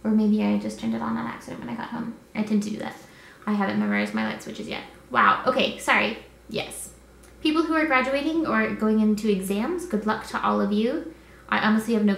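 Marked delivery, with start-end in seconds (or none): none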